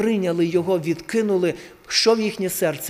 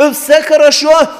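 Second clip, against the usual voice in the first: shout; 6 semitones higher, 9 dB louder than usual. Acted. Shouted climax.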